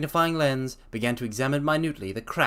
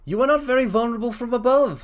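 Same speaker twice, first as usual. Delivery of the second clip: higher, louder voice for Holmes; 12 semitones higher, 6 dB louder than usual. Acted. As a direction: play the detective, louder and lifted.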